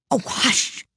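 high, breath-filled urgent hush; frightened whisper. A narrator's reading. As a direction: shush them in fear of being overheard. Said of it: "whispered in a frightened tone".

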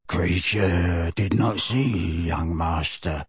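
low, slow, croaking grumble under the breath; hoarse bullfrog mutter. A narrator's reading. Old house elf grumbling to himself, low croaking mutter.

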